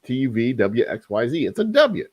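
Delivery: hammed-up defence of his own way of saying it; mock indignant retort. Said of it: "I'm like".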